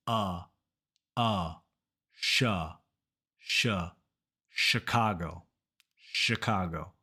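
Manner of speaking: uh, sh, then Chicago as "shuh-KAH-go"; low falling models of Chicago with pauses; sound-by-sound drill. Shown as a demonstration. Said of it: "that vowel is uh".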